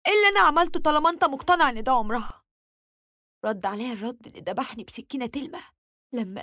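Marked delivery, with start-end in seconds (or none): 0.1-2.4 higher, louder, rapid-fire demand; indignant challenge. A narrator's reading. Wife arguing with husband over the blame, indignant. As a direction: demand an answer, exasperated.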